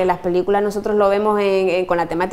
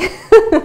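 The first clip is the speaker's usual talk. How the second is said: quick laugh, pitched high and louder; a bright burst of laughter; teacher laughing about all-German lessons; short high laugh; 12 semitones higher, 6 dB louder than usual. Real, not acted. Laughs at too-strong immersion.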